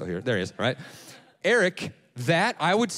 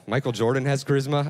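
ordinary talk, nothing marked

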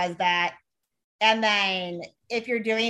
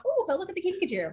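high, soft, fast cooing; 9 semitones higher, 5 dB quieter, much faster than usual. Retold or imitated. People gushing over a cute kinkajou, soft high coo. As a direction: gush over a cute animal.

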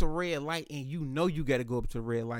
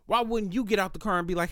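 usual speaking voice

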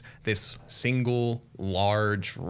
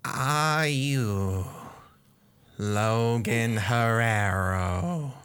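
breathy whispered chorus, slow on the name; rat swarm voice; 2 semitones higher, much slower than usual. Acted. Rat swarm questioning the player, eerie whisper. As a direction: whisper it like a hundred rats at once. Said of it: "The rats look at you and go".

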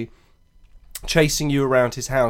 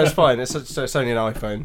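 usual speaking voice

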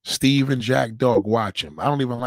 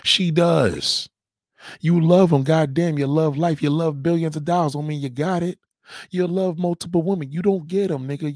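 higher, louder scornful taunting; loud mocking jeer; 4 semitones higher, 5 dB louder than usual. Real, not acted. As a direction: ridicule him at full voice. Mocking the man's claim loudly.

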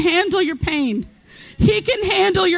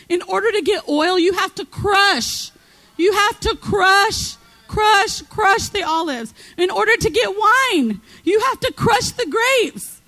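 fervent preaching; 5 semitones higher than usual. Real, not acted. Preacher driving home the crushing to the congregation, fervent.

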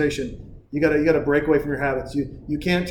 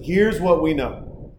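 normal talking voice

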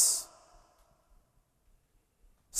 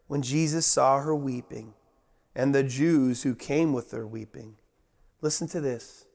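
ordinary delivery

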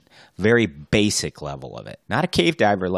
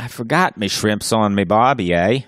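lightly put-on Australian accent; a playful hint of Aussie twang; mock Australian accent; 3 semitones higher, 5 dB louder than usual. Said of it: "Australian accents".

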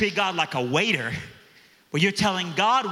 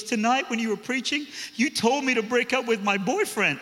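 put-on higher girlish voice, earnest; young girl voice; 7 semitones higher than usual. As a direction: play the teen girl, pitched up and sincere.

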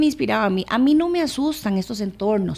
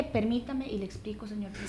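much softer, politely asking to explain; soft retold plea; 4 semitones lower, 16 dB quieter than usual. Minister recalling her plea to the president, very soft.

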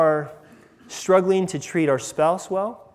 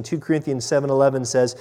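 usual speaking voice

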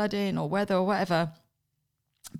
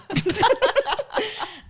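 loud high laugh; 14 semitones higher, 6 dB louder than usual. Real, not acted. Laughing at her own baby-making story, a big high laugh.

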